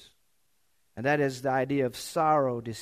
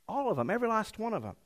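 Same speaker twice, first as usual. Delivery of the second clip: high, soft, offhand voice; imitating a casual, understated storyteller; mock understatement; 5 semitones higher, 5 dB quieter, faster than usual. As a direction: slip it in lightly, pitched up. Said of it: "he's always the master of understatement".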